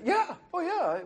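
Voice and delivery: chirpy high yes, slowed down; imitating a cheerful clerk; high eager agreement. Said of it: "they said".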